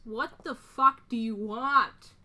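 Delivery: loud, slow, angry demand; furious. Acted. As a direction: drag out each word in loud anger. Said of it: "absolutely furious".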